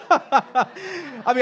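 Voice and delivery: high laugh before the words. Real, not acted. Laughing at own joke.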